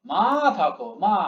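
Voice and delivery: "মা" held long before "থ ক"; drawn-out syllable. Shown as a demonstration. Teacher showing the pull needed, "মা" long-drawn. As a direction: stretch the vowel of "মা". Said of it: "অনেক টান দিতে হবে".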